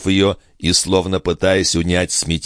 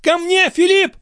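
very high, frantic calls; panicked high cry; calling for help; 3 dB louder than usual. A narrator's reading. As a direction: summon him desperately, rising each time.

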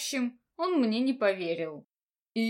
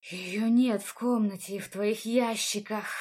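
angry hiss. A narrator's reading. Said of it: "Со злостью прошипел незнакомец".

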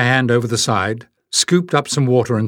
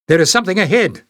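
higher, louder sudden alert; exclaiming; 7 semitones higher, 3 dB louder, much faster than usual. Acted. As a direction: point it out with excitement. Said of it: "Jasmine exclaimed".

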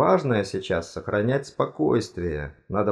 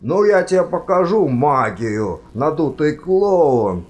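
loud, higher threatening yell; furious yell; 6 semitones higher, 5 dB louder, slower than usual. A narrator's reading. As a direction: yell it, drawing out the insult. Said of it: "завопил, размахивая руками". (яростный вопль)

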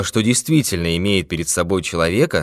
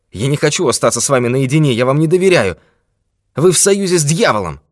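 high, raised agitated voice; agitated distrust; 9 semitones higher, 7 dB louder, faster than usual. A narrator's reading. Old man refusing to be alone with the detective, agitated. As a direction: build to a loud accusation.

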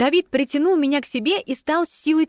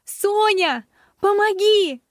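shouting for help; 8 semitones higher, much slower than usual. Acted. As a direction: scream her name, higher. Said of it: "прокричать". (громкий крик о помощи)

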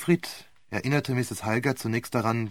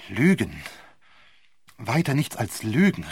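agitated cry. Acted. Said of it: "rief die Zeugin erregt".

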